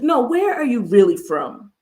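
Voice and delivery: acted pushy, doubting question; insistent prying. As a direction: imitate someone pressing for another answer.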